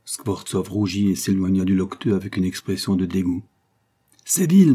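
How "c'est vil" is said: sharp high angry outburst, much louder; irritated cry. A narrator's reading. Skvortsov to the lying beggar, angry shout. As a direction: spit out the insult in disgust. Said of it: "lui cria-t-il, irrité".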